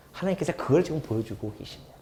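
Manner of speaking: solemn whispered line. Real, not acted. Preacher revealing God's lesson to the congregation, whispered. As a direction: whisper it like a revelation.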